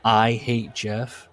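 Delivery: slow, weighty dramatic read; mock-solemn punchline delivery; short and powerful. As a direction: drop it like a bold opening line. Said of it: "the short but powerful sentence".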